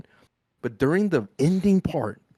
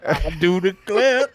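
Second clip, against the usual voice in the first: slow, louder southern drawl; southern accent; 4 semitones higher, 6 dB louder than usual. Pretend. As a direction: ham up a genteel southern lawyer. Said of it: "if I say it in this southern accent".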